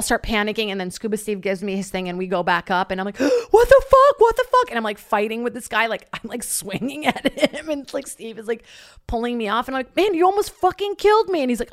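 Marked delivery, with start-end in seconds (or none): 3.2-4.7 shrieking high and loud in panic; panicked scream. Retold or imitated. To the panicking diver after surfacing, a high panicked shriek. 10.0-11.4 high, loud furious outburst; angry yell. Retold or imitated. At the kid who nearly drowned her, high angry yell.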